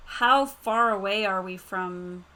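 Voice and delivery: normal talking voice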